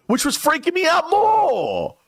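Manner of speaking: high-pitched comic wail; exasperated panic. Retold or imitated. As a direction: cry it out in mock despair, stretching the end. Exasperated climax of panic story.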